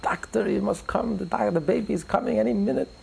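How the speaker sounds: high-pitched mock yell, pleading; hammed-up call for the doctor; mock yell in French. Woman in labor calls the ignoring doctor, high mock yell. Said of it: "she yells out in the beautiful French".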